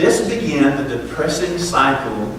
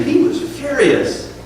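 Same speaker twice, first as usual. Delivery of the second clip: "furious" drawn out, higher; stress on furious; 9 semitones higher, slower than usual. Acted. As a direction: lean hard on "furious". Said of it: "Jonah wasn't just angry".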